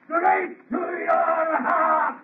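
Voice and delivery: slow, drawn-out taunting chant; gloating monster. Acted. Monster taunting Frankenstein after killing his bride, slow gloating chant. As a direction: savour every word of the threat.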